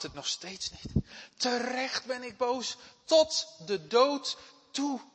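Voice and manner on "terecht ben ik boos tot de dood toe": high, loud, slowed-down outburst; angry defiant Jona. Acted. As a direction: Jona insisting on his anger to God. Voicing Jona's angry reply. (boze, opstandige Jona)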